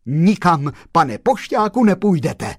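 loud, high, emphatic veto; forceful gentleman cutting in; firm refusal. A narrator's reading. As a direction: forbid it outright. Gentleman firmly stops the postman.

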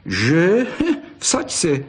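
mocking. A narrator's reading. Pepík taunts his friend over the fox, mocking. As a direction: jeer with a little laugh. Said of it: "posmíval se Pepík".

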